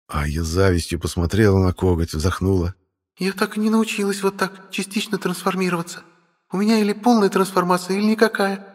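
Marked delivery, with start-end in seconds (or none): 3.2-8.7 put-on high, girlish complaining voice; envious dragon sister. Sister envies brother's claw, high-pitched complaint. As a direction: lament it as the little sister.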